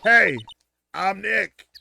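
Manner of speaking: loud. Shown as a demonstration. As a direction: shout the name so the camera hears. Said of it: "Maybe I'm not saying it loud enough".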